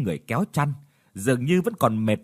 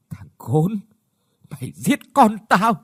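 choked, bitter threat, louder on the middle line; bitter vow of revenge; 2 semitones higher, 3 dB louder than usual. Acted. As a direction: swear revenge through grief and rage. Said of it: "uất ức mà khóc nấc".

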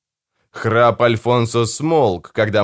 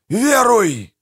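high, fervent cry; high-pitched, commanding shout; 12 semitones higher than usual. A narrator's reading. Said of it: "кричал Манфред".

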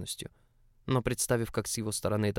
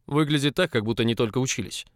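growling; 3 semitones higher, 6 dB louder than usual. A narrator's reading. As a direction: growl it out, barely holding anger. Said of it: "прорычал Август".

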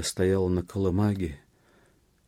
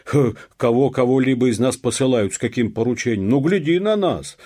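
high, loud indignant clamour; clamorous protest; 7 semitones higher, 10 dB louder than usual. A narrator's reading. Peasants argue back at the police, loud clamour. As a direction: raise a noisy outcry, pitched up. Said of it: "зашумели мужики".

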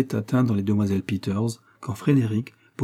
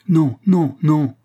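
high, loud flustered denial; agitated outcry; 7 semitones higher, 8 dB louder, much slower than usual. A narrator's reading. Agnès denying she ever guessed his love, greatly agitated. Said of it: "s'écria Agnès en grand émoi".

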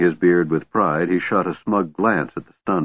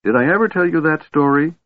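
high, eager question; eager chatter; 9 semitones higher than usual. A narrator's reading. Kender offers the dwarf a story, eager. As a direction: pitch it up, itching to tell the tale.

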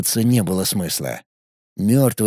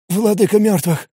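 crestfallen voice; 8 semitones higher than usual. Acted. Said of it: "упавшим голосом произнёс Пимен".

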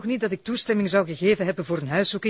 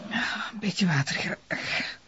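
fluisterend van pijn; 4 semitones higher, 6 dB quieter, much slower than usual. Acted. Vrouw na pijnscheut, vraagt water.

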